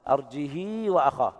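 slow chant stretching ه into a long ii; kasra with sila. Shown as a demonstration. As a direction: recite أرجه as arjihii, the ه carried on a long i. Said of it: "هكذا".

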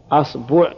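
أصبع as "asbu'", a first, u after ba; fatha then damma. Shown as a demonstration. Teacher listing dialect forms, أصبع as "asbu'". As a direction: say أصبع with opening a and second vowel u. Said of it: "والضم".

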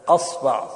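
"أصبع" as asba', a in both syllables; fatha on hamza. Shown as a demonstration. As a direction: say "أصبع" with a first and a after the b. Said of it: "افتح الهمزة". (نطق "أصبع" بفتح الهمزة والباء)

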